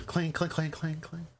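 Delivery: high metallic clang imitation; clanging noise. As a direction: chant the clangs high like tin on stone. GM voices clattering noise.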